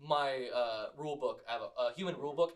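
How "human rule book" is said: ordinary delivery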